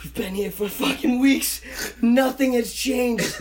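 high, slowing, retold bewilderment; stunned disbelief. Tripper up a tree at endless sunrise, retold stunned disbelief. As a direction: stretch out the amazement, pitch climbing.

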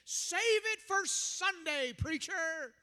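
loud, drawn-out mock heckle; imitated heckler's yell. Hecklers to street preachers, mock yelling. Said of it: "they used to yell at us".

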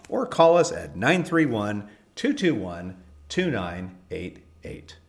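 upbeat promo announcer voice; ad read. Acted. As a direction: sell it like a radio spot. Recorded ad read.

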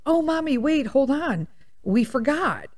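put-on high, eager little boy calling mommy; lightly voiced eager child; excited kid voice. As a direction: son piping up. Son calling mom back.

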